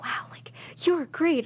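high, hushed compliment; whispered praise. Retold or imitated. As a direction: whisper praise to a fellow actor. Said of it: "everyone being like".

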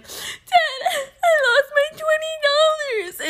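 high-pitched wail to dad; hammed-up sobbing complaint; mock crying. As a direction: re-enact a tearful, whining cry. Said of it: "and I was crying".

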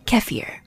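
"kefir" as KEF-eer, short e stressed; Russian pronunciation. Shown as a demonstration. Teacher showing the Russian version, KEF-eer "kefir". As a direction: say "kefir" slowly with KEF first, then eer. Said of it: "keep the Russian pronunciation".

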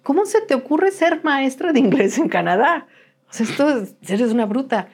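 high-pitched, exasperated telling-off of oneself; indignant retold self-reproach; retold self-scolding. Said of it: "Salí y lloré y dije".

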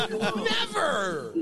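high-pitched cry through laughter; amused, horrified outburst; emphatic laughing refusal. Real, not acted. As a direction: refuse outright, voice leaping up high.

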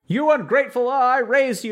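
a mock-outraged parent; angry parent. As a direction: scold the ungrateful child. Angry parent attacking child.

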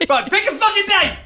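high, loud, fed-up outburst; exasperated shout. Real, not acted. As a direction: yell in mock exasperation. Exasperated at rejected names.

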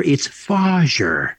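slow "Fajer" stretched out, Dutch-style; sons' nickname "Fajer" dragged out slowly; drawn-out Fajer. Retold or imitated. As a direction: lean into "Fajer" slowly like Goldmember. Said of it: "that's all my boys call me".

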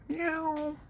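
one high, soft meow; a tiny cat's meow. Retold or imitated. Teacher meows softly to the class like a cat.

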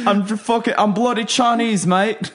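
hammed-up British accent; mock British accent. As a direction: overdo the bloke accent for laughs. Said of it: "British halfway through".

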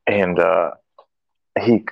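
ordinary talk

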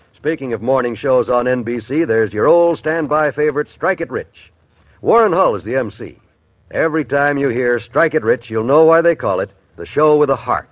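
ordinary talk, nothing marked

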